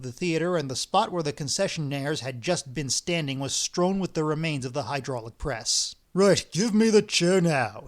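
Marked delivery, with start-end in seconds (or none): 6.1-7.8 slow, loud, icy demand; cold demand. Acted. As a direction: lay it down coldly, slowly. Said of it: "said Bender coldly".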